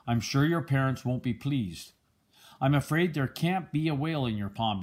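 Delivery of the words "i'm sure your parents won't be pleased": plain reading voice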